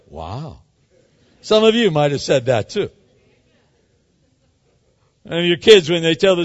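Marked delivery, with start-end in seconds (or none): 0.1-0.6 mock stunned wow. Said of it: "don't look at me like".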